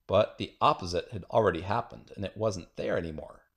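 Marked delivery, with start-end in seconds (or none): none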